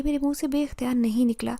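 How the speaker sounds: ordinary delivery, nothing marked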